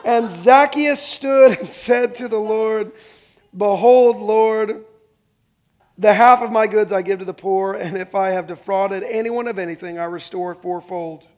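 loud, slow Bible reading; scripture reading. Acted. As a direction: read Zacchaeus' vow out steadily.

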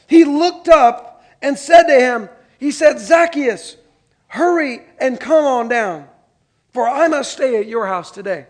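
loud, slow and deliberate Bible reading; scripture reading. Acted. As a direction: read the passage out to the congregation, slowly and loudly. Pastor reads Luke 19 aloud.

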